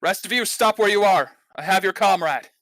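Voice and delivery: loud, high-pitched commanding shout; forceful warning to a whole group; shouted threat. Acted. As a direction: call out orders as a hostage-taker. Ambusher shouts orders at the party.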